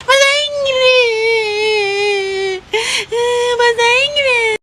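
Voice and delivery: tearful, whimpering insistence; crying. Acted. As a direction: sob the words out like a hurt child. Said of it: "kok kalian pada nangis sih". (merengek sambil menangis)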